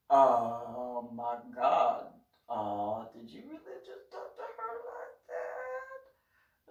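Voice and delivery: slow, hushed mock horror; stunned disbelief. Retold David, low then high disbelief.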